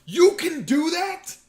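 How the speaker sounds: high, loud, slow disbelief; amazed kid. Retold or imitated. Boy watching a racer play PS one, amazed. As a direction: gasp it out like a kid seeing magic. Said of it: "I was like".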